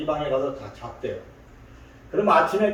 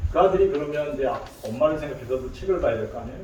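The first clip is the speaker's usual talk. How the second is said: ordinary talk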